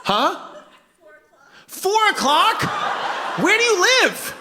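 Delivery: high-pitched, hammed-up disbelief; incredulous. Comedian questions latecomer about leaving at four, high incredulity. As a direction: pitch shoots up in mock astonishment.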